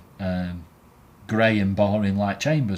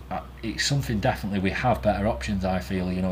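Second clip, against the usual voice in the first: ordinary talk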